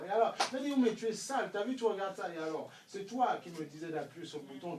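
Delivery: ordinary talk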